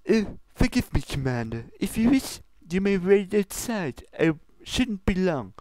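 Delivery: high, formal princess scolding; lofty royal reproach, pitched high. Acted. Luna scolds Pinkie about her ingredients, high and prim.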